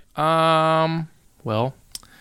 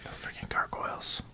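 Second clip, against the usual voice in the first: whisper; 13 dB quieter than usual. Real, not acted. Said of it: "whispered aside".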